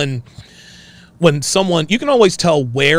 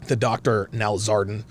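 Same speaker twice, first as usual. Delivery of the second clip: ordinary delivery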